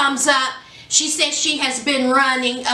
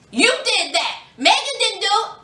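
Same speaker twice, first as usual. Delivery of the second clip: ordinary talk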